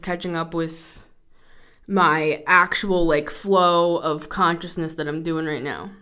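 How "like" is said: usual speaking voice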